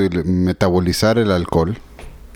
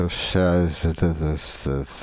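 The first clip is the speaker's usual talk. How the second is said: put-on drunk voice; 2 semitones lower, 5 dB quieter, much slower than usual. Shown as a demonstration. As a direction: drag the words like a drunk. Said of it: "La clásica voz de borracho".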